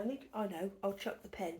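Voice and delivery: ordinary talk, nothing marked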